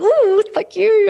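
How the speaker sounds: very high, loud and slow "Ooh, thank you"; delighted squeal. Retold or imitated. Mother thanking her daughter, a drawn-out high squeal of delight. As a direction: gush over the compliment like a thrilled fan. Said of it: "I'm like".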